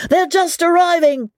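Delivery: very high, full-voiced announcing cry; excited shout. A narrator's reading. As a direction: call it out to the whole inn. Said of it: "shouted at the top of his voice".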